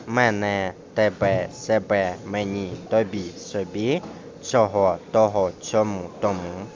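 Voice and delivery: МЕНЕ, ТЕБЕ, СЕБЕ and the rest, each with the last syllable stressed; stress on endings. Shown as a demonstration. Teacher drilling pronouns, stress on endings. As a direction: list evenly, beat on every final syllable. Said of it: "мають наголос у закінченні".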